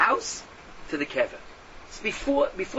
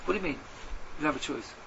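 usual speaking voice

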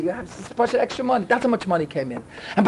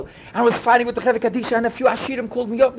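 ordinary talk

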